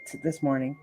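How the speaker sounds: ordinary talk, nothing marked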